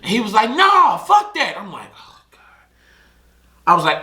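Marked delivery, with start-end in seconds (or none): 0.5-1.5 hammed-up angry passenger saying no; mock defiant friend. Passenger refusing, acted. 2.0-2.4 scared whisper to himself; whispered oh god. Retold or imitated. Scared aside under breath.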